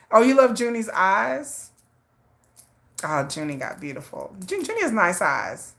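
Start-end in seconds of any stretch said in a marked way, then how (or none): none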